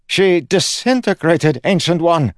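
alarmed guard. Acted. Guard tells the Ancient One what vanished, alarmed cry. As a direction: cry it out in high-pitched alarm. Guard reports after screaming.

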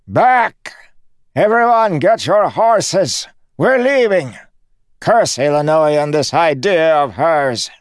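high, loud shouting; panicked shouted orders. Acted. Il Reo orders everyone to flee, panicked shouting.

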